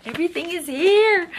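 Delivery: high, loud thrilled exclaim; excited exclaim. Real, not acted. Vlogger showing the complete papers, thrilled exclaim. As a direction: announce it with real thrill.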